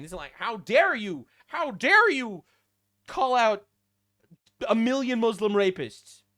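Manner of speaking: normal talking voice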